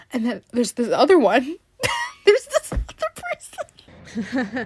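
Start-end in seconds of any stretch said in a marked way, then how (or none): 1.9-4.7 high, squeaky laugh through the words; real laughter. Real, not acted. Introducing this other person to listeners, laughing helplessly. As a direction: crack up while talking, voice shooting up. Cracks up introducing next story.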